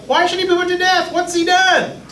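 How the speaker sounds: high, fast, pleading protest; Jonathan protesting. Retold or imitated. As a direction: plead high and quick for your friend's life. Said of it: "and Jonathan's like".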